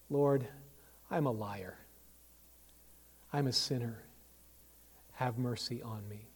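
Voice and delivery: soft, low prayer; hushed penitent plea; humble confession. Acted. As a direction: confess quietly to God, pausing between phrases. Recites hymn, then prays as sinner.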